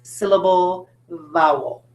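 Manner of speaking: slow, word-by-word teacher's model; say-after-me model. Shown as a demonstration. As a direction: space out each word for pupils to repeat. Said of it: "Can you say that?".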